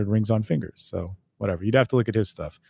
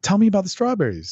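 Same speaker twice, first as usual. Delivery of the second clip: eager; 10 semitones higher than usual. Retold or imitated. Voicing the eager target.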